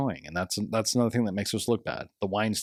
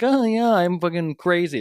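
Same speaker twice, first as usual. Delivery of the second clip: mocking quote; 6 semitones higher, 4 dB louder than usual. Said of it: "it's like".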